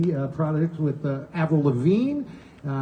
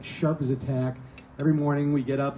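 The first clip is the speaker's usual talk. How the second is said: usual speaking voice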